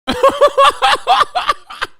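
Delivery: long, high laughing fit. Real, not acted. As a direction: laugh hard, then let it fall.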